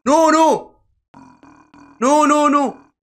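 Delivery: high, loud panicked screaming; terrified scream. Real, not acted. Player caught by the monster, screaming in terror. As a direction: shriek in real terror. Chased in horror game.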